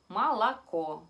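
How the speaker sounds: молоко said loudly as "malakó"; only the last O stays in молоко; O reduced to A. Shown as a demonstration. Teacher gives the word for milk, O as A. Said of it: "nosotros simplemente decimos".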